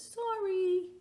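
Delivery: put-on high, small child apology; meek little boy. Little boy apologizing to the grumpy man, high. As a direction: say it like a startled child.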